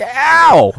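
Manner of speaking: high yelp. Retold or imitated. Stung by bee.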